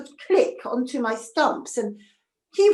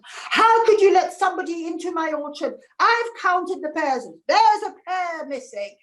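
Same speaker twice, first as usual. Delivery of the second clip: higher and louder, furious orchard owner; angry shout; 5 semitones higher, 4 dB louder than usual. Acted. Orchard owner shouting at his servant, angry and louder. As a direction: bellow at the servant over a missing pear. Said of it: "A man was shouting at another man".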